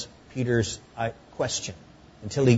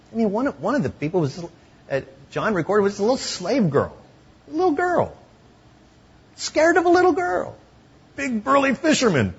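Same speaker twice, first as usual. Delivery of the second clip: mock disbelief; 10 semitones higher, 3 dB louder than usual. Mocking the scared fisherman.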